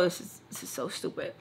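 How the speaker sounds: sulky, almost silent whisper; whispered complaint. Retold or imitated. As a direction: mutter it under your breath, annoyed. Angry rant under her breath.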